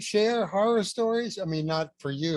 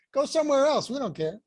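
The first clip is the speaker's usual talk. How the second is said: laughing, higher brush-off; mock dismissive; 8 semitones higher, 3 dB louder than usual. Uncaring store clerk waving a customer off, mock dismissive. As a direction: toss it away like a clerk who doesn't care.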